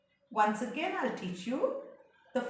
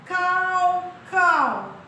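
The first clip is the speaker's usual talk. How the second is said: rook call; 7 semitones higher, 8 dB louder than usual. Acted. Rooks' call in poem.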